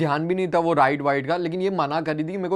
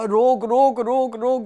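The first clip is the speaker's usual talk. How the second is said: urgent yell; 6 semitones higher, slower than usual. Retold or imitated. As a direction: shout for the operator to stop. Yelling to stop the ride. (घबराकर "रोक, रोक" चिल्लाना)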